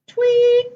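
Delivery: high, loud chirped tweet; one high, sustained mock birdcall; bird tweet. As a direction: chirp once like a little bird. Story reader voices little bird.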